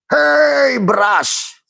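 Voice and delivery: high, very slow drawn-out call; mock yellow rice voice.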